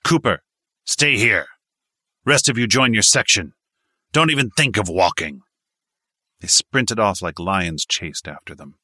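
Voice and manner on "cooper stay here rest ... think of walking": higher, louder commanding voice; sergeant's order. A narrator's reading. Sergeant holding Cooper back, loud commanding orders. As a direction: clip the orders at the squad.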